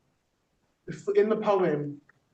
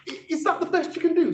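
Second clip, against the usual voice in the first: usual speaking voice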